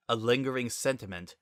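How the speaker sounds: ordinary delivery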